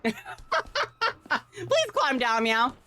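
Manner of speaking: "Please climb down" through a laugh; giggly pleading. Real, not acted. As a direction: beg while cracking up. Pleading with game character, laughing.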